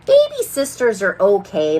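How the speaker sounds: ordinary delivery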